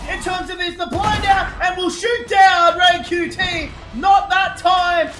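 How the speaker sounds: rising hyped play-by-play; excited call. Real, not acted. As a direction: build to a shout on the kill. Caster calls decisive duel.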